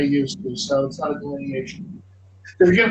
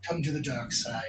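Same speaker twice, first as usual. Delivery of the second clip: mock dark-side lure; 2 semitones lower, 12 dB quieter than usual. Coach luring an opera singer into accent work, mock-sinister hush. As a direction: softly tempt them over, playfully sinister.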